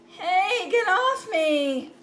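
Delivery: loud, high, slow get off me; annoyed shout. Acted. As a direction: shoo the gnat off. Character annoyed by gnat.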